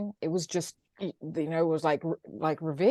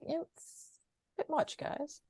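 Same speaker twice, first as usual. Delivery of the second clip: high, breathless whisper of disbelief; whispered aside; 11 semitones higher, 13 dB quieter, slower than usual. Retold or imitated. Whispering to listeners about the island accent, teasing. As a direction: hush it like a cheeky aside. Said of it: "I'm like".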